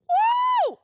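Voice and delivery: very high, loud "woo"; excited whoop. Real, not acted. Reviewer loving the terrible movie, whooping with glee. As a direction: let out a long party whoop.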